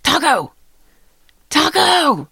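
calling out. Retold or imitated. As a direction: call the lost dog into the rain. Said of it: "Pete is screaming out the window".

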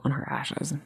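trailing into a whisper. Real, not acted. About the plaque on the dog's ashes, whispered softly. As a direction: let it fade to a whisper.

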